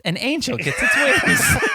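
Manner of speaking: hammed-up tearjerker delivery; mock sentimental movie line. Joke parodying sentimental film line.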